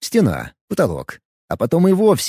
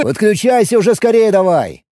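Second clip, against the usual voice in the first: high, loud impatient shout; 7 semitones higher, 4 dB louder than usual. Acted. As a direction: shout to hurry him up. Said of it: "крикнул я Бобу".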